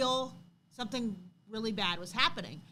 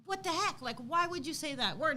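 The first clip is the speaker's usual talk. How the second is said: incredulous; 6 semitones higher than usual. Retold or imitated. Mom scolding son in retelling.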